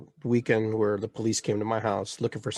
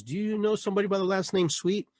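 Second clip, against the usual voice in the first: cop questioning; 9 semitones higher than usual. Retold or imitated. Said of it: "then he goes".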